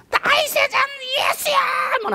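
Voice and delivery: very high loud cry; demo cry. Shown as a demonstration. Preacher demonstrating the cry for the congregation, loud. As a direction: show them how to cry out. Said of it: "하는 거예요".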